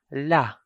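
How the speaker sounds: "la" with an ordinary, open A; a plain-length "la"; normal vowel. Shown as a demonstration. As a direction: say "la" without prolonging or stopping it. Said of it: "Just a normal sound".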